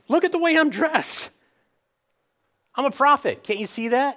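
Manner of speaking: high, loud, hammed-up self-promotion; mock-indignant prophet demanding recognition; mock boasting. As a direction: insist on your importance in a loud, high voice. Acting out John's imagined boast.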